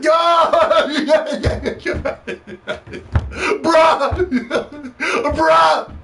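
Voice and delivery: high, loud imitation of fans going wild, laughing; mock fanboy cheering. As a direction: parody a hyped crowd whooping and laughing. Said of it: "That's how they talk".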